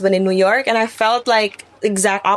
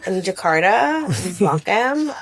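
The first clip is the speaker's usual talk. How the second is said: put-on valley girl. Shown as a demonstration. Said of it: "Can I hear this Valley Girl accent?".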